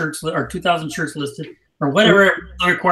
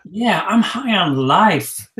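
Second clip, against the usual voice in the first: drawn out, higher-pitched mock bliss; retold blissed-out reply; 4 semitones higher, 3 dB louder, much slower than usual. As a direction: stretch it slowly, playing up the happiness. Acting out reply to daughter.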